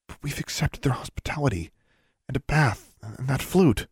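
high, hushed, worried whisper; whispered worry. A narrator's reading. Girl whispering her worry to the elf. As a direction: breathe it out in alarm.

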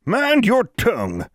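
put-on slow, high sharp old woman's rebuke; snapping. A narrator's reading. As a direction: snap it like a stern granny. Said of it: "snapped Granny Morrison".

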